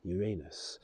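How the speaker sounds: Uranus said as you-RAY-nus; Uranus, RAY version. Shown as a demonstration. Showing how people say it, Uranus as you-RAY-nus. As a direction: stress a long RAY in the middle of Uranus. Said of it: "'cause people say it how they want".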